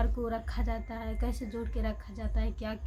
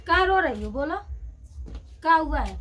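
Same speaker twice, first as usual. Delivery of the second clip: loud coaxing; 4 semitones higher, 6 dB louder than usual. Real, not acted. Mother asks crying baby daughter why she cries, loud sing-song coaxing.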